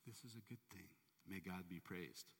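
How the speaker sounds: quiet unshaken faith; serene blessing. Retold or imitated. Servant in jail answering the king, very softly. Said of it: "the servant goes".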